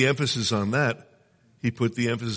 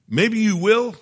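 emphatic high retort; 11 semitones higher, 8 dB louder, slower than usual. Real, not acted. Preacher answering a defeatist thought with a loud emphatic retort.

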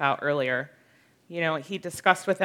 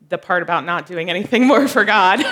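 louder, breaking into real laughter; laughing; 4 semitones higher, 7 dB louder than usual. Real, not acted. Speaker taking back own joke about quitting, laughing. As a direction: crack up as you finish the line.

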